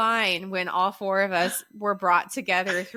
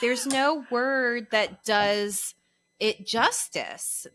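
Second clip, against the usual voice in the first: slow; much slower than usual. Retold or imitated. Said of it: "I'm like".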